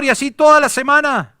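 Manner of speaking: high, loud, driving sermon delivery; preaching at full force. Acted. Pastor urging the congregation to obey the Lord, preaching at full force. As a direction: preach it hard to the congregation, climbing.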